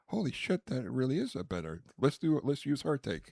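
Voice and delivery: high-pitched imagined revelation; imitating an astonished director; mock amazement. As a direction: act out being suddenly won over, voice jumping up. Imagines director won over while editing.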